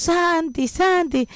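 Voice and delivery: retold call for someone out of sight; imitated calling out. Sister calls little brother in for food, mock calling out.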